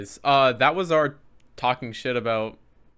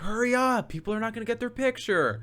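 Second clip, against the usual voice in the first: imitated high-pitched nagging; relentless line handler; 10 semitones higher than usual. Woman rushing fans through photos, relentless and high. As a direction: hurry them along in a raised pitch. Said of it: "she was being, like, relentless with it".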